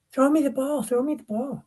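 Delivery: put-on high, pleading little-boy voice; kid begging. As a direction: beg like a ten-year-old in the stands.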